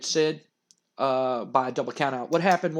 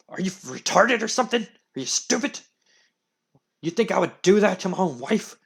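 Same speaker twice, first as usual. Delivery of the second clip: angry boss rant; 4 dB louder than usual. Retold or imitated. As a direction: berate him like an idiot. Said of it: "Vince McMahon goes".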